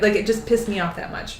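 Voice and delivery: usual speaking voice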